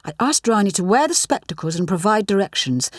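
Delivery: plain reading voice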